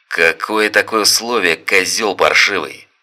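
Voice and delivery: loud, hostile insult; angry yell. Acted. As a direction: bark the insult. Thug insulting Sergei.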